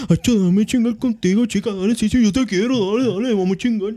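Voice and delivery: mocking singer voice.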